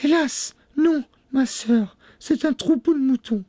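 very high, regretful falsetto; sister Anne. A narrator's reading. Sister disappointing the wife, high and plaintive. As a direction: call down the bad news plaintively.

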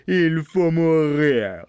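menacing Bluebeard. A narrator's reading. Bluebeard condemning his wife, slow and merciless. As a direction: stretch the death sentence menacingly. Bluebeard pronounces death again.

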